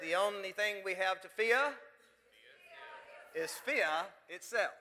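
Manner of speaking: slow, badly imitated FDR accent with "fear" as "fee-ah"; lousy FDR accent. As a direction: do a poor FDR accent, drawn out. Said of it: "That was a lousy imitation of his accent".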